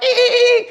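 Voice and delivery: very high-pitched dolphin "ee" chirps; imitated dolphin call; dolphin squeal imitation. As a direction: squeal in short bursts like Flipper. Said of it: "the dolphin's like".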